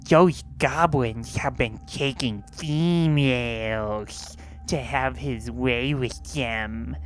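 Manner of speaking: low, slow know-it-all voice; low smug know-it-all. Retold or imitated. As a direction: lecture them, smug and slow. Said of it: "Ronathan immediately speaks up and says".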